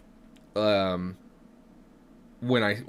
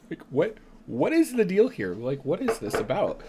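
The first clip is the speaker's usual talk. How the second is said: laughing; 8 semitones higher than usual. Real, not acted. Laughing at the deck.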